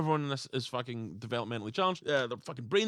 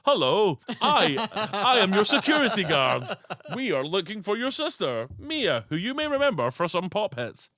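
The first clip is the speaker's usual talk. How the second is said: a stiff, formal security guard, higher and louder; mock stiff guard; 6 semitones higher, 6 dB louder than usual. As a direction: announce it like a wooden, overly formal guard.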